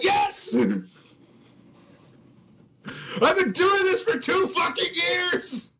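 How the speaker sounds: very high squealed outburst; mock exasperated scream. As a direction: crack under nagging, screeching it comically. Acted answer to micromanager.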